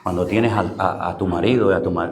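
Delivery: normal talking voice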